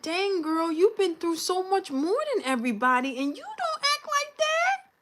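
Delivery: praising herself in the mirror, up high; proud self-talk. Retold or imitated. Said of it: "I said".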